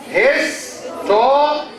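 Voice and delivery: "Historic" slowly in three separate pieces, his-to-ric; syllable by syllable. Shown as a demonstration. Teacher counts the syllables for the class, "Historic" slowly split up. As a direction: break "Historic" into syllables, drawn out. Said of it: "How many syllables does this word have".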